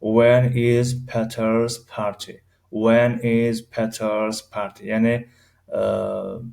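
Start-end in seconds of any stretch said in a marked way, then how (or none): 0.0-4.8 My birthday is in June را خیلی آهسته و شمرده بگو; کلمه به کلمه، آرام برای شاگرد; slow model reading. Shown as a demonstration. (جملهٔ نمونه با سرعت بسیار کند)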